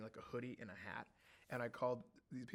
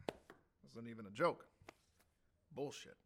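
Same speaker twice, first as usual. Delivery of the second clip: a hint of Luther; 3 semitones lower than usual. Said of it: "a skosh of Luther in there".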